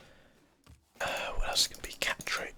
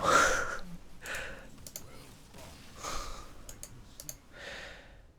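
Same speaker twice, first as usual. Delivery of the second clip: laugh. Real, not acted. Laughs at opponent's play.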